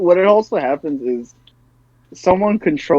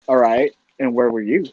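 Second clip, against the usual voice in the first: confident questioning; 6 semitones lower than usual. Retold or imitated. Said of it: "With confidence too".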